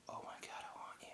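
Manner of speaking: hushed, breath-only swoon; very soft imitation of desire; mock smitten whisper. Voicing woman smitten by Bond.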